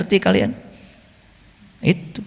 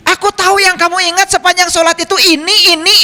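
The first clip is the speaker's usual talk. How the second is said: God shouting; 10 semitones higher, faster than usual. Pretend. Preacher imagines God yelling at daydreaming worshippers, in a mock shout. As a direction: call it out like God exposing them. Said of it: "Coba Allah kalau teriak".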